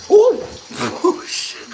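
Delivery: loud high ooh; surprised shriek. Real, not acted. Reacting to water squirting, loud surprised ooh. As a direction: burst out startled.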